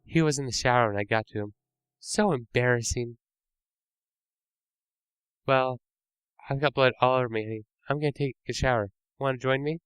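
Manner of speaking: put-on high, sing-song killer girlfriend; sweetly menacing, tease him; creepy girlfriend.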